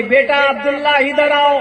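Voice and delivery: loud call across the house. Retold or imitated. Mother calling her eldest son Abdullah, loud summons.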